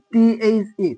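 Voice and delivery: usual speaking voice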